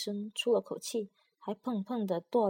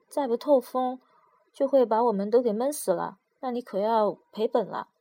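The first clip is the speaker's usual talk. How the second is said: loud, raised call through the box lid; 2 semitones higher, 7 dB louder than usual. A narrator's reading. 闷在盒子里的药丸大声向外面的老陈喊话. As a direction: shout to be heard outside. Calling out to merchant outside.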